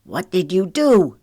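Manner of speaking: tense, forceful hiss. A narrator's reading. Trent confronts Wiley, hissing. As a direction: hiss the accusation. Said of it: "he hissed at Wiley".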